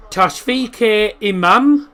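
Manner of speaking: Tashfik Imam drawn out slowly, rising at the end; name tried out slowly. Shown as a demonstration. Streamer trying a viewer's name, slow unsure pronunciation. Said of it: "I'm sorry if I've pronounced that wrong".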